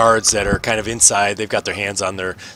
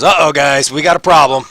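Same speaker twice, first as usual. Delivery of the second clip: alarmed guard; 5 semitones higher, 8 dB louder than usual. Acted. Guard finds contraband.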